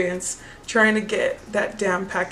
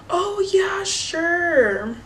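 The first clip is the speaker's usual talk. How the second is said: much higher, airy dismissive "sure"; mock breezy saleslady; 9 semitones higher, much slower than usual. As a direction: toss it off carelessly.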